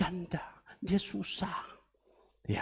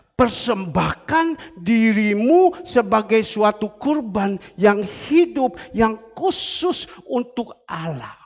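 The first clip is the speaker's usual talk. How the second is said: loud, slow, weighty preaching; preacher driving home a verse; emphatic scripture reading; 7 dB louder, slower than usual. Acted. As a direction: declaim the verse slowly and forcefully.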